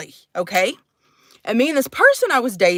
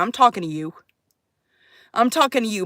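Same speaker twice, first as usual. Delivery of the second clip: normal talking voice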